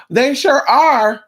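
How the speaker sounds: slow, loud, emphatic agreement. Real, not acted. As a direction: lean hard on each word, drawn out.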